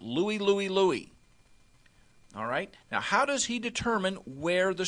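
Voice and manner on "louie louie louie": mild demo shout of the call; yell out. Shown as a demonstration. Linebacker calling strength left to the defense, mild shout. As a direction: call it across the line. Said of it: "he's gonna yell out".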